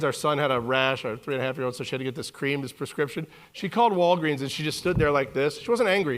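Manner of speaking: ordinary talk, nothing marked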